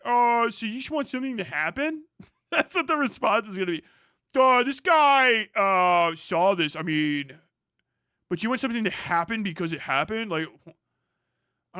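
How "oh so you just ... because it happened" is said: mocking critic. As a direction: parody the critics as dim.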